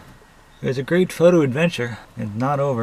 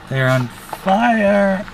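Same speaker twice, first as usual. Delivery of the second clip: high, drawn-out cry of pain; 8 semitones higher, 4 dB louder, much slower than usual. Real, not acted. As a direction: wail it out, legs burning. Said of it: "my legs are on fire".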